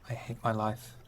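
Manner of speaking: usual speaking voice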